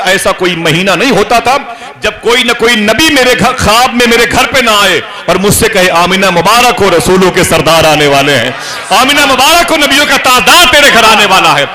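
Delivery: high, loud, joyous announcing; soaring, shouted blessing; jubilant proclamation. Acted. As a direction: ring it out like glad news. Prophets' glad tidings, preaching.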